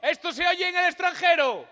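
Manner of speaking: high, very loud hammed-up yell; mock shouting mayor. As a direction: bellow it like a pompous mayor. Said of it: "¿por qué chillamos?".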